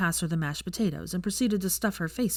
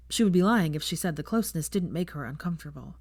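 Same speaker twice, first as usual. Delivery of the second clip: plain reading voice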